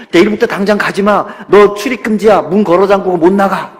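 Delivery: loud, high angry parent forbidding; angry shout. Retold or imitated. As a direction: bark out the ban like a furious parent. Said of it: "야단치고 막 혼내고". (화가 나서 소리치는 부모)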